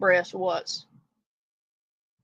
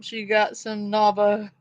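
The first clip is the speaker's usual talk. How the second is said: ordinary delivery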